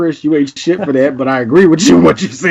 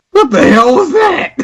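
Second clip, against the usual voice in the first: high rasping clown outburst; Krusty the Clown; 12 semitones higher than usual. Retold or imitated. Recalling the trailer, doing Krusty's high outburst. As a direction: do Krusty choking on his cigarette. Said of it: "what Krusty the Clown did on Simpsons".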